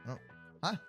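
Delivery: ordinary delivery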